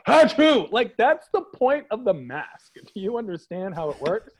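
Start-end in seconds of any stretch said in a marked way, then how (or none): 0.1-0.7 loud, high acted sneeze; mock sneeze. Imitating dad sneezing with mask down, loud mock sneeze. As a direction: let out a big imitated sneeze. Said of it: "Persian people are about to sneeze".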